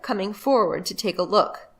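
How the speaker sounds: ordinary delivery, nothing marked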